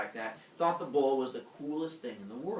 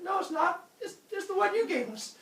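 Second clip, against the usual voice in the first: high-pitched defensive mock protest; comic squabbling denial. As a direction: insist on a bad lie, voice way up. Acted argument over the bull.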